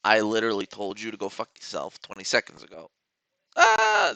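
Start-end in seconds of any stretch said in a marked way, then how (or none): 3.6-4.1 sudden high yelled ah; high mock yell; loud shout. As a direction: blurt it out at the top of the voice. Shouts mock insult at delayed chat.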